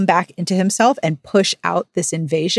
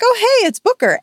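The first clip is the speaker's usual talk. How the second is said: much higher, bright sing-song hello; heroine's cheerful greeting; 3 dB louder, faster than usual. Retold or imitated. Retelling the heroine greeting Booker, in a high mock-cheerful voice. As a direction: pop up high like she's happy to see him.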